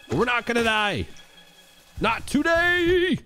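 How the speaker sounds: high-pitched rallying squeal, stretching the last word; high defiant cry. Real, not acted. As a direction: cry out defiance in the heat of the game. Defying death in the game.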